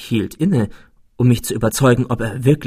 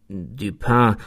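measured, earnest address; serious tone. A narrator's reading. Narrator addresses his friend Dupin in earnest, serious tone. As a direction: say the name gravely. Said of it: "sagte ich in ernstem Ton".

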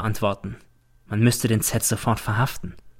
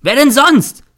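high, loud accusing yell; boy screaming at his rival; furious shout; 9 dB louder, slower than usual. A narrator's reading. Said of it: "schreit der Z".

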